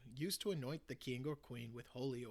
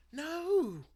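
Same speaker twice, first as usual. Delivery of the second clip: high, loud anguished outcry; desperate cry; 11 dB louder than usual. Acted. Ben crying out as the soaked match fails, desperate. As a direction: cry out as if all hope is gone. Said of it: "cried Ben in desperation".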